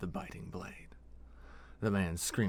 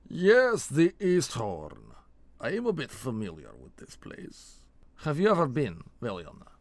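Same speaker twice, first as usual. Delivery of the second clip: put-on thick Elkexi accent; 5 semitones higher, 9 dB louder than usual. A narrator's reading. Vold answering his partner after looking around, thick Elkexi accent. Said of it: "in a thick Elkexi accent".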